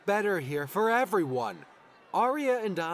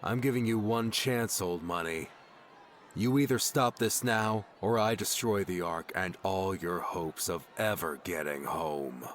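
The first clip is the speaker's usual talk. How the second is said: low threat; 8 semitones lower than usual. A narrator's reading. Sim threatens to destroy the Ark, low cold threat.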